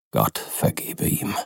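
langsam, tief und leise gehaucht; feierlich geflüstert. A narrator's reading. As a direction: ein ehrfürchtiges, leises Gebet. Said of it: "sagte der Advokat feierlich". (Utterson flüstert nach dem Schrecken feierlich)

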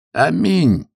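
громкий высокий возглас «Аминь!»; выкрикни «Аминь!» высоко и громко; крик. A narrator's reading. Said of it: "крикнул истопник". (громкий выкрик истопника из котельной)

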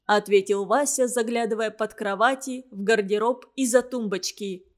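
usual speaking voice

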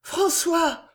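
high, eager call into the night; breathless call. A narrator's reading. Jeanne calling out anxiously for her lover. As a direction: call his name, heart pounding. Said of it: "appela-t-elle palpitante".

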